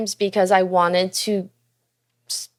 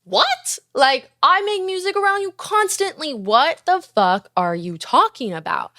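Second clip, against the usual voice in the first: high, sharp "what?" of disbelief, then the rest slowing; stunned disbelief; 7 semitones higher than usual. Retold or imitated. Retelling her shock at girlfriend's DJ dream, disbelieving. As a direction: replay the moment you couldn't believe her. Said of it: "I was like".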